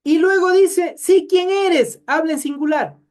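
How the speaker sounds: high, loud emphatic preaching; preaching at full force. Acted. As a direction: proclaim it to the congregation. (predicación enérgica, aguda y fuerte)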